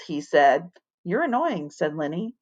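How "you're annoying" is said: soft irritated mutter; annoyed mutter. Acted. Lenny annoyed.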